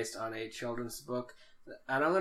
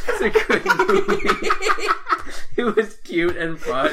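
high laughing through the words; 9 semitones higher, 7 dB louder than usual. Real, not acted. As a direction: crack up mid-sentence.

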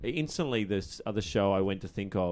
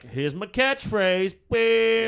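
slow, higher hammed-up sitcom line with a beep; mock sitcom catchphrase; 9 semitones higher, 3 dB louder, much slower than usual. Mocking nineties sitcoms, slow hammed-up catchphrase and beep. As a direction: parody an old sitcom catchphrase. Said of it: "it was like".

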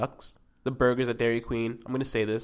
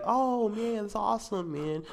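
fake excitement; 9 semitones higher, much slower than usual. Showing what people don't expect, fake excitement. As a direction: gush over it, obviously faking. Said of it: "You're faking that expression".

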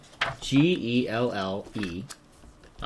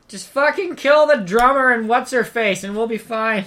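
higher, louder yell offstage; from another room; 9 semitones higher, 7 dB louder than usual. Acted. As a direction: shout orders through the wall. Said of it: "Eugene yells to the next room".

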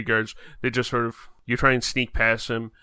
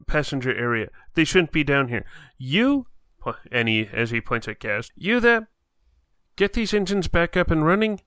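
high, loud barked orders; commanding officer; 4 semitones higher, 4 dB louder than usual. Acted. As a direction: take charge of the crew. GM voices officer giving orders.

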